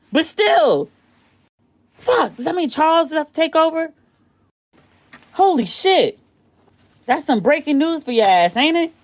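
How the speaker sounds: can't believe it, rising what; stunned. Real, not acted. Reacting to breaking news, stunned and high.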